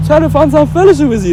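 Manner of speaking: mock eager questioner. Mimicking a friendly questioner.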